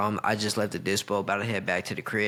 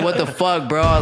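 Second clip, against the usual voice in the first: higher, louder yell of disbelief; retold yell; 6 semitones higher, 7 dB louder, slower than usual. Yelling out loud so his cousin hears from the next room. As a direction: shout it to the whole house. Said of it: "I yelled out loud".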